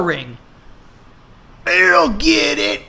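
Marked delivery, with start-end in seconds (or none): 1.7-2.8 high, slow voiced dad; gruff dad. A narrator's reading. Billy Ray answering the doorbell. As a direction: call out to get the door.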